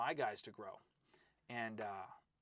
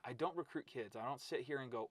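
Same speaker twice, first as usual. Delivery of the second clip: ordinary talk, nothing marked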